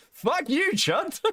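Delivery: high, giggling fake fury; laughing mock insult. Streamer laughing at Chud, mock-furious high giggle. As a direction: curse him out while cracking up.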